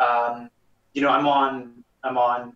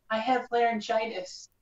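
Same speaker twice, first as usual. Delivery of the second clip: put-on high young girl's voice, much higher; little girl voice; 10 semitones higher than usual. Shown as a demonstration. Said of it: "Do you do girl voices too?".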